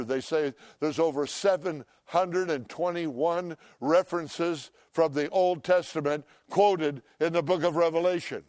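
ordinary talk, nothing marked

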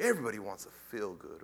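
ordinary talk, nothing marked

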